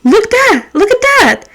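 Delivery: high eager boss pointing something out; lightly voiced eager boss; excited boss. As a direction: play the boss showing off his snack. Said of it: "And he's like".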